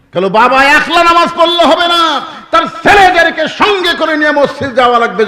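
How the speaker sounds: loud, high fiery admonition; full-force preaching. Real, not acted. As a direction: thunder the rebuke out over the congregation. (গলা ফাটিয়ে জ্বালাময়ী ওয়াজ)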